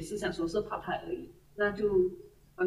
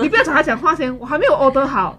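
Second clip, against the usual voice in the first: louder mock scolding with a laugh; retold angry telling-off; 6 semitones higher, 12 dB louder than usual. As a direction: repeat the rebuke, louder, half laughing. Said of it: "他说".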